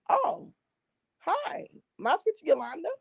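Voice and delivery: high, polite phone greeting; sweet high phone voice. Retold or imitated. Calling a wrong number, she asks for Yolanda sweetly and high.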